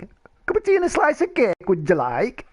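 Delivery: high, fast, put-on posh voice; prim English host, sped up and shrill; mock posh voice. Mock-posh take on tea phrase.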